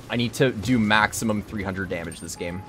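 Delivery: ordinary talk, nothing marked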